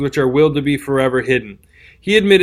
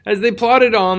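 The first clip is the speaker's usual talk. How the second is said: ordinary delivery, nothing marked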